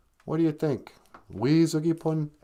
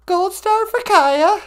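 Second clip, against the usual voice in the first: high cheery announcer; 5 dB louder, slower than usual. Pretend.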